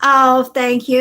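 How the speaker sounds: ordinary talk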